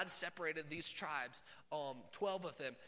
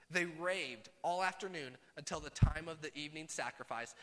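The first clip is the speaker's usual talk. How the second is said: ordinary talk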